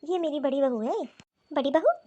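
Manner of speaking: usual speaking voice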